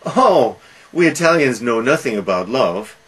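loud, high mock-amazed "Oh", easing down; sarcastic. Salieri answering Mozart on love, mock sarcastic. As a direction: lay on the retold sarcasm. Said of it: "Salieri says sarcastically".

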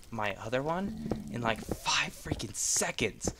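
slow breathless whisper; whispered aside. Real, not acted. Telling viewers how fast it went, whispered. Aside comparing build times.

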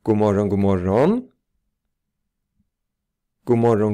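"God morgon, god morgon" with energy, final "morgon" swooping up; melodic model greeting. Shown as a demonstration. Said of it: "Lyssna på melodin. Lyssna på energin". (energiskt "God morgon, god morgon", sista "morgon" stiger tydligt)

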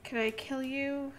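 usual speaking voice

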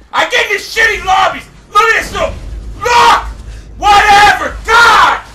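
high, loud outburst building to a shout; exasperated rant. Real, not acted. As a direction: rage at the lousy lobby. Gamer rants about lobbies.

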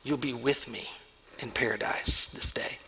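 Jesus whispers to the dying criminal, hushed and gentle; soft whisper. Retold or imitated. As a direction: whisper it gently, like a last promise. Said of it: "Jesus responded back".

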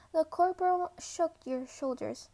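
plain reading voice

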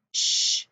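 SH as a long unvoiced hush; isolated digraph sound. Shown as a demonstration. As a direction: sound out "sh" as in shark.

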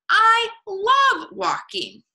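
high, loud, drawn-out delight; stretched-out animal enthusiasm; thrilled Beaver. A narrator's reading. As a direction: gush it slowly in a high beaver voice. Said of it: "gasped Beaver".

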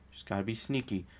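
sneaky hush. Shown as a demonstration. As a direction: drop it to a secretive hush.

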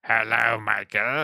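a hint of a villain greeting; lightly menacing villain hello; bad guy voice. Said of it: "William Daniels doing a bad guy voice".

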